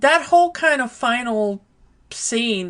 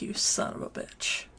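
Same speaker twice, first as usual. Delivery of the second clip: whispered; 13 dB quieter than usual. Retold or imitated. Quoted vengeful final line.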